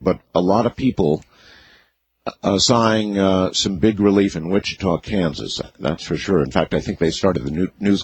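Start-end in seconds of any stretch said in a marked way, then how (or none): none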